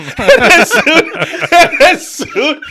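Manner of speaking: high, laughing, slowed words. Real, not acted. Friend recounting the manager's line, laughing hard. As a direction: get the words out through laughter.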